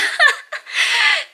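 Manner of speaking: a burst of high laughter; a squealing laugh; high helpless laugh. Real, not acted. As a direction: laugh helplessly at your own blunder. Laughs about missed food orders.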